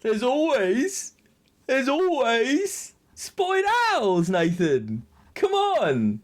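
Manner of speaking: high, slow drawn-out insistence; mock exasperated plea. Real, not acted. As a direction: beg Nathan to get it.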